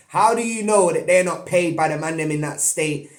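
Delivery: ordinary talk, nothing marked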